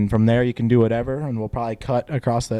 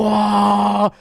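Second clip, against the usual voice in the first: 1 mock alarmed whoa; 7 semitones higher, 4 dB louder than usual. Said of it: "you're back there just".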